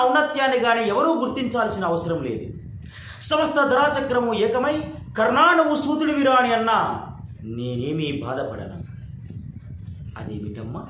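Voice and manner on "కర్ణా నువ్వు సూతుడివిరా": mock jeer. World jeering at him, quoted.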